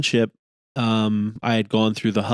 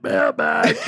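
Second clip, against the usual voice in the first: stretched, high mock holler; mimicked segment shout. Host recalling the mailbag thing, drawn-out mock shout. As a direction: imitate an over-the-top segment call.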